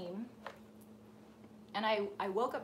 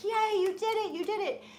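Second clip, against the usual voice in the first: much higher, louder gleeful cheer; elated cheering; 10 semitones higher, 5 dB louder than usual. Retold or imitated. Mother bird cheering her flying baby, elated. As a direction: cheer like a proud mama bird. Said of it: "jumping up and down and cheering and elated".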